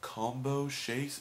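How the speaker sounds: drawn-out, emphatic clerk's question; slow careful repeating. Retold or imitated. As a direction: spell it out word by word for confirmation. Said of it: "I kept repeating it".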